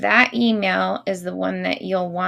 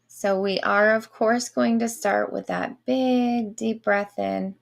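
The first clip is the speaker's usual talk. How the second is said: slow guided breathing voice; airy breathy mystical healer; slower than usual. Acted. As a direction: draw the breath out slowly, soothing. Leads breathing for Reiki.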